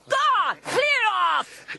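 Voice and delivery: furious mother shouting; angry shout. Acted. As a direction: drive him off the doorstep. Mother throws son out.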